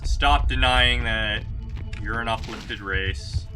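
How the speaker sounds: usual speaking voice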